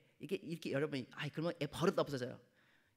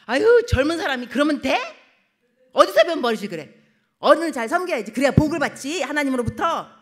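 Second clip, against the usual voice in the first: loud, higher rebuke; much louder, stern lecture; scolding elder; 8 semitones higher, 15 dB louder than usual. Retold or imitated. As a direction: tell off the young woman sharply. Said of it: "막 이러면은".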